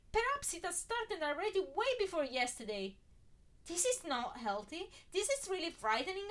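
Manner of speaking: high, loud frightened rush; panicked. Acted. She realises Harry has erased Ginny, panicked. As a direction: thoughts racing in alarm.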